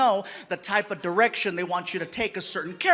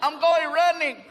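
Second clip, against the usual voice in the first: put-on drunk voice; 7 semitones higher, 4 dB louder than usual. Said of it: "when I'm drunk".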